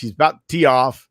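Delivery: normal talking voice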